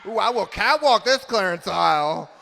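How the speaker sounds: loud, high diva swagger; fabulous runway voice; sassy strut. Pretend. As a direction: strut down the aisle with it. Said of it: "truly feel like a ten".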